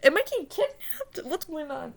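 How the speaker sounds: high, hushed-ending panicked questions; retold scare. Dreamer fearing a kidnapping, scared. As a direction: act out startled alarm, starting very high. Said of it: "I get scared".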